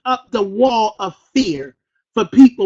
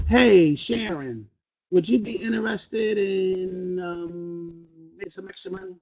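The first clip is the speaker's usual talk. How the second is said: slow, low and much softer, dragging; too slow; 5 semitones lower, 8 dB quieter than usual. Shown as a demonstration. Showing recruits the wrong slow pitch to Sharon, slow and trailing. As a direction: drag the pitch out lazily with a filler um. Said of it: "If you're speaking fast, you can't be like".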